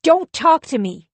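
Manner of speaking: high, loud furious shout. Acted. Husband shouts at his wife to back off, furious yelling. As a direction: yell him off. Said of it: "he shouts".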